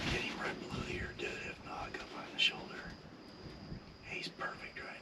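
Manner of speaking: very soft, urgent-free whisper; barely audible whispered coaching; hunter's whisper. Real, not acted. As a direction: whisper the aim point to the shooter. Coaching a shot at live game.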